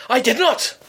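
high indignant mock voice of an older man; pompous neighbour. Neighbour lectures her at the door, high and pompous. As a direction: puff up like an officious ex-copper.

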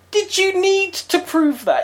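high, slow disbelieving question; incredulous. Retold or imitated. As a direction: ask it in outraged disbelief. Indignant retold inner thought.

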